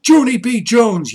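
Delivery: high, loud call of her name; mother's loud angry call; yelling name. A narrator's reading. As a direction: yell for the runaway child. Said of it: "yelled Father".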